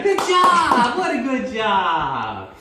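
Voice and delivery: high, loud, slow cheer. Shown as a demonstration. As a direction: cheer the dog on big. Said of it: "you let go and you cheer".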